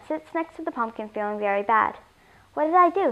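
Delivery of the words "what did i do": higher-pitched, rueful sigh; sighing regret. A narrator's reading. Alien realises he ate her pumpkin, sighing regret. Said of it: "he sighs".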